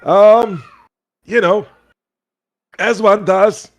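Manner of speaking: put-on high, loud, folksy old-timer voice; squeaky cheerful old boggart greeting travellers. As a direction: play the friendly old fisherman by the fire. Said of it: "he goes".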